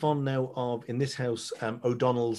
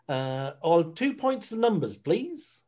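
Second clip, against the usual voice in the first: a hint of a Norfolk accent, rising high on please; 6 semitones higher, 5 dB louder, slower than usual. A Norfolk local ordering two pints, light Norfolk accent. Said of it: "very particular accent".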